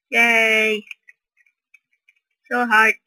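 normal talking voice

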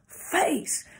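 sharp high one-word drill order; military execution command. Shown as a demonstration. Speaker finishing a turn command, sharp military call. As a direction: fire off the order in drill style. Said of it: "do you notice the inflection in the voice".

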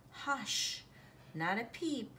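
usual speaking voice

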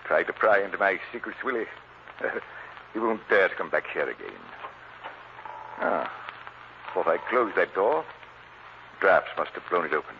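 low, sneering villain muttering to himself; gloating villain. Acted. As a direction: gloat over the meddling fool, voice dropped low. Villain gloating alone in his house.

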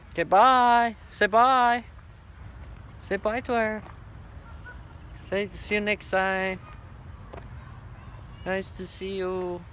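high, singled-out farewell prompts; high-pitched pet talk. Real, not acted. Telling Joseph to say bye to her, high-pitched pet talk.

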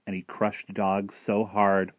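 plain reading voice

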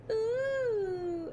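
long high-pitched "ooh"; intrigued "ooh". Real, not acted. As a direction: coo with delighted interest, high and drawn out. Delighted at finding a romance book.

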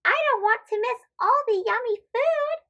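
put-on high, eager little girl. Farnaz jumping out of bed for breakfast, high eager voice. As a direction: bounce out of bed eager for food. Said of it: "Farnaz jumps out of bed".

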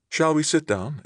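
high-pitched polite suggestion; hostess voice. A narrator's reading. Hostess inviting her guest to sit, politely. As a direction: lightly play the hostess, pitched well up.

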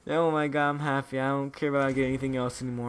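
usual speaking voice

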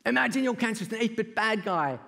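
ordinary delivery

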